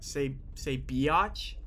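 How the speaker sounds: biatch drawn out slowly, bee-atch; saying biatch. Retold or imitated. Retelling the word he heard as a kid, slowly drawn. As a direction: stretch biatch into bee-AH-tch. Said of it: "So I used to say biatch".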